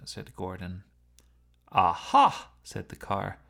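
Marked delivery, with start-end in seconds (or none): 1.7-2.5 loud, high aha of discovery; a sudden loud surprised aha. A narrator's reading. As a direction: pounce on the realisation. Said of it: "in a tone of surprise".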